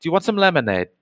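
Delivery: high, sugary hostess voice; sweet 1950s housewife. Acted. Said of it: "It's like".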